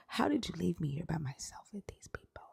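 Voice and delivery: hushed, unvoiced whisper to the dead. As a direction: breathe it into his ear. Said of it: "I whispered in his ear".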